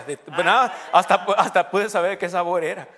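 laughing through the punchline; can barely get it out for laughing. Real, not acted. Preacher laughing at his own cheap-shoes joke.

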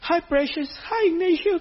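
a hint of a fond mother's greeting; sweet greeting. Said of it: "Mom Betty así me dice".

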